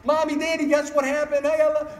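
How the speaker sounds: put-on high, loud, breathless child bursting in; excited kid. Kids run in shouting to their parents, high excited child. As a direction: play a small kid rushing to tell news. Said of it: "Kids come running".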